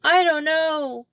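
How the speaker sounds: put-on high, slow child's voice, tearful; a lost child answering through tears; sobbing little girl. A narrator's reading. Molly answers Betsy from the pit, sobbing. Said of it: "came Molly's sobbing voice".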